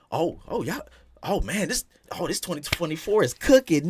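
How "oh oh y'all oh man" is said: ordinary delivery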